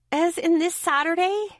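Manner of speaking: high-pitched grumbled protest; grumbling. A narrator's reading. Friend stuck watching her cousins that day, grumbling. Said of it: "she grumbled".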